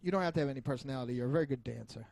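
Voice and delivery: ordinary delivery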